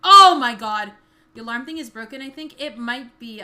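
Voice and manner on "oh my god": sudden loud yell; loud shout. Real, not acted. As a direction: yell it out in shock. Sudden game shock.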